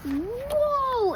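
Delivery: ordinary delivery, nothing marked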